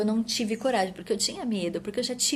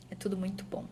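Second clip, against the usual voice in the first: whispered; 7 dB quieter, faster than usual. Real, not acted. Tender remark on motherhood.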